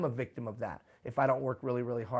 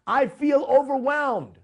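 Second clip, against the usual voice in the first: high, slow, altered overwhelmed wail; hammed-up overwhelmed cry; 11 semitones higher, 8 dB louder, slower than usual. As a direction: moan it like it is all too much. Acts out an overwhelmed thought.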